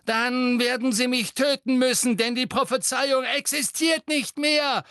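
high, loud yell; roared. A narrator's reading. Harry answering Bellatrix's threat, roaring. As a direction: bellow it back defiantly. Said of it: "brüllte Harry".

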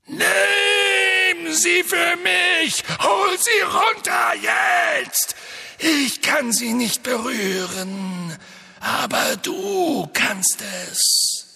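high cold shrieking villain; Voldemort voice. A narrator's reading. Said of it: "eine hohe, kalte Stimme".

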